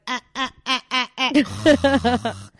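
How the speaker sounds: short, loud rhythmic yelps; punchy repeated "ah" noises; mock hyped-up grunts. Twerking in bed to hype music, mock rhythmic yelps.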